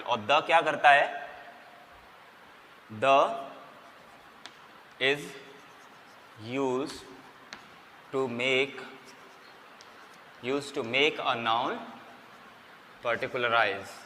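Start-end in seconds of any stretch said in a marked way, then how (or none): 3.0-13.9 very slow, soft word-by-word dictation; hushed, drawn-out dictation. Shown as a demonstration. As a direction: give each word for students to write. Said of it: "dictating the definition".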